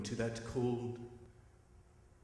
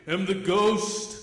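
booming; 5 semitones higher, 7 dB louder, slower than usual. Acted. Spirit announces itself.

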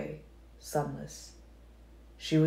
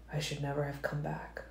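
much softer, a near whisper; 9 dB quieter than usual. A narrator's reading. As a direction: breathe it out under the voice. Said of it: "I whispered".

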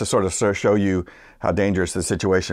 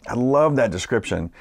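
ordinary talk, nothing marked

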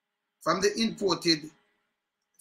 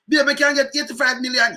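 high mimicked girlfriend voice; 8 semitones higher, 7 dB louder than usual.

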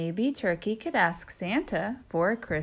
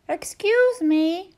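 high, slow and timid; polite turkey voice; 10 semitones higher, 7 dB louder, slower than usual. Acted. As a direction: ask the way shyly.

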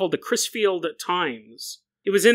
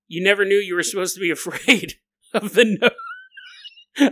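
ordinary talk, nothing marked

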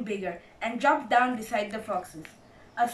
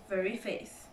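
plain reading voice

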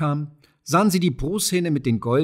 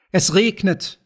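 higher-pitched, lightly voiced children's call; 5 semitones higher, 4 dB louder, slower than usual. A narrator's reading. Children running through the raindrops, calling out high. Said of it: "riefen die Kinder".

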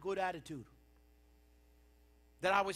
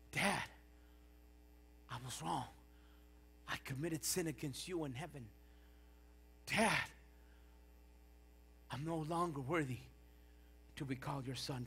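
humble confession; 6 semitones lower, 7 dB quieter than usual. Acted. Acts the prodigal son's confession.

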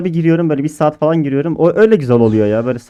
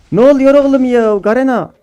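high, loud indignant cry; high-pitched exasperated yelp; outraged disbelief; 10 semitones higher, 4 dB louder than usual. Real, not acted. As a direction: protest at the game in disbelief. Game did not count kill.

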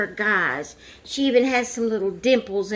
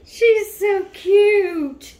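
high, slow, louder cooing squeal; adoring squeal; 11 semitones higher, 7 dB louder, much slower than usual. Real, not acted. Mother cooing over her new doll, high drawn-out delighted squeal. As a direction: stretch it out in a delighted high coo.

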